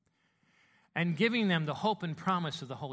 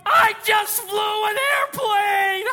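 high, very loud, stretched-out yell; ecstatic shout; 13 dB louder, much slower than usual. Acted. New pilot celebrating inside after first solo, ecstatic shout. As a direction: explode with elation, holding out the words. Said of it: "You are so excited".

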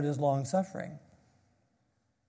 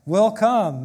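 WILL COME loud, higher and firm; very emphatic; 5 semitones higher, 9 dB louder than usual. Shown as a demonstration. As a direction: hammer "Will come" with conviction. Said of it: "you make it very emphatic".

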